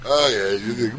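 ordinary talk

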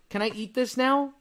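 lightly voiced three-year-old; imitated child. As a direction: ask it like a small boy. Voicing his young son.

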